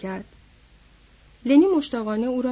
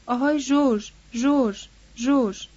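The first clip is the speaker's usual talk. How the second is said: mildly raised call, name repeated. A narrator's reading. Lennie calling George for help, mild narrated cry. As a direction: call for help with understated urgency. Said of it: "و فریاد زد".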